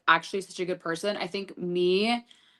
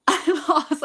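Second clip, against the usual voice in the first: upset wail; 9 semitones higher, 4 dB louder than usual. Retold or imitated. Retelling reaction to producer.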